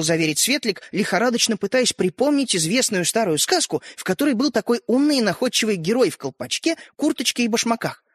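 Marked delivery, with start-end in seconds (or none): none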